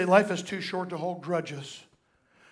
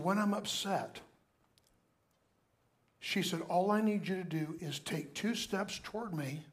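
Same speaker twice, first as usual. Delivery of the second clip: softer, gentle quoted plea; tender confiding wife; 6 dB quieter than usual. Retold or imitated. Pastor quoting his wife's gentle request, softened.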